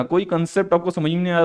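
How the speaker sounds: ordinary talk, nothing marked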